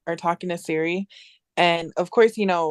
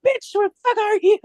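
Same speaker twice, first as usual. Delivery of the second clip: high-pitched mock outrage; hammed-up demand for an answer; exasperated demand; 12 semitones higher, 4 dB louder than usual. Owner to unresponsive Siri, exasperated high demand. Scolding a phone that won't respond.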